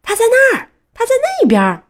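high, loud excited yell; urgent high-pitched holler; shouting. A narrator's reading. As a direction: shout out that you've spotted him. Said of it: "板牙大喊".

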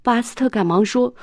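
plain reading voice

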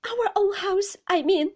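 high, anxious self-correction; faltering, frightened. A narrator's reading. Mother correcting herself before her husband, high and frightened. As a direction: voice a flustered, frightened wife. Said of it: "faltered my mother, evidently frightened".

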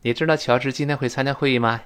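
usual speaking voice

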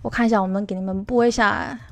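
ordinary talk

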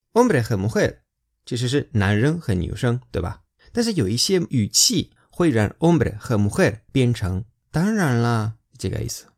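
ordinary talk

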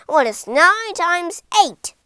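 loud, high teacher's challenge; teacher challenging the pupil loudly; stern demand. Acted. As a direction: fire the question at him. Teacher quizzes Ben sternly.

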